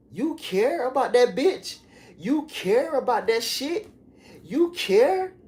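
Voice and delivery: high-pitched jeering questions; mocking taunt. Mocking [name] for caring about money, high jeering.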